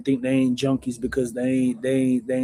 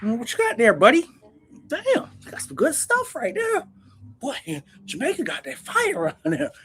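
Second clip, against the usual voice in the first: much higher put-on voice of an old buyer; toothless customer voice; 12 semitones higher than usual. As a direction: play a toothless fiend admiring the dope. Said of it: "So they".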